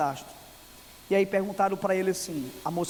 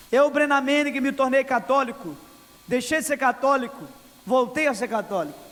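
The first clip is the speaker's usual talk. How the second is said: loud, higher declaring voice for the author; preached quote; 5 semitones higher, 5 dB louder than usual. Retold or imitated. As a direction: declaim the confession like a testimony. Preacher voicing Brennan Manning's book. (citação pregada em voz alta)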